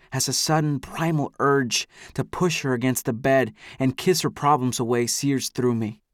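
ordinary delivery, nothing marked

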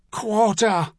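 high, sudden bawl. A narrator's reading. Dragoon begging for quarter, high bawl. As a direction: cry out for mercy. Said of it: "someone bawled suddenly".

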